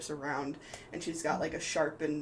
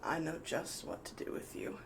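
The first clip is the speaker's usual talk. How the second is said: soft, half-whispered menace; sinister whisper; 5 dB quieter than usual. Acted. Sophia threatens the fallen Eutoris, quiet whispered menace. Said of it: "she goes".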